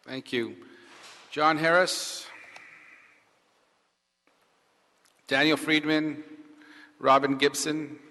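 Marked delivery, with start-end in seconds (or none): 1.3-8.0 loud, evenly spaced roll call; calling out names. Real, not acted. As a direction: call each name out to the room. Calling up the next speakers.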